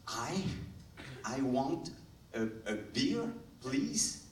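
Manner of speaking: halting, nervously broken-up English with a lifted "please"; anxious English beginner. Acted. Shy French speaker ordering a beer at the bar, anxious and halting. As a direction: order timidly in a second language, pausing between words. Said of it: "Ça m'angoisse un petit peu".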